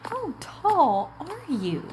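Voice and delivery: ordinary talk, nothing marked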